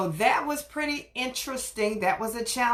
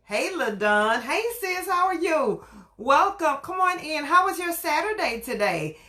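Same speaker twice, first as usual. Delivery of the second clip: excited greeting; 6 semitones higher than usual. Real, not acted. Greeting a friend joining live.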